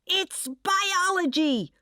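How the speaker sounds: slow, strained high-pitched scream. A narrator's reading. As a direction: scream it back defensively. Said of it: "And I screamed".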